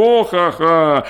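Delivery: short mocking laugh, drawn out slowly; slow sneering ha-ha. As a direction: laugh it off bitterly. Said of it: "засміявся господар".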